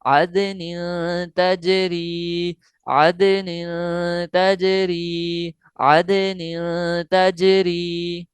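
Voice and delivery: very slow melodic Quran recitation; tajweed recitation. Shown as a demonstration. Teacher showing how to read it, very slow recitation. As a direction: draw each word out like a chant. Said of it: "इसको कैसे पढ़ना है?".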